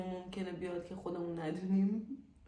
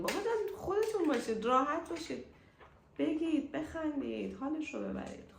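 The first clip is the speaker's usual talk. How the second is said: cheery urging; 6 semitones higher than usual. Real, not acted. Urging viewers to be themselves.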